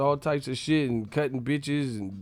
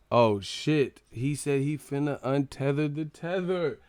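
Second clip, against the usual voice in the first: ordinary delivery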